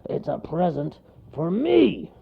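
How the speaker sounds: rising to a loud, high finish; grumble, then relish the last word; self-satisfied gruff bear; grunting bear. A narrator's reading. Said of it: "grunted the bear".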